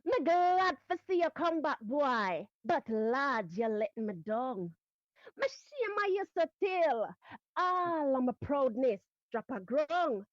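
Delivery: performed Jamaican dialect poem, building indignation; Jamaican patois recitation. Shown as a demonstration. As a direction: recite Miss Lou's Jamaican verse like a scolding auntie. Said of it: "So le-, it goes like this".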